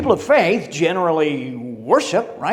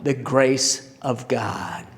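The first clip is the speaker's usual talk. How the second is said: softer, slower knowing answer; gentle smile; 6 semitones lower, 6 dB quieter, slower than usual. Acted. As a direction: let it land quietly, kindly. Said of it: "Saint Peter smiled at him".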